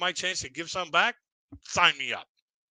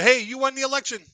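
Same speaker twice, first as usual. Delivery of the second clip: much higher and louder, mock cheery announcement; cheery caller; 10 semitones higher, 5 dB louder than usual. Caller telling the sender of the sample, mock cheery news. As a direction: sell the good news like a game show host.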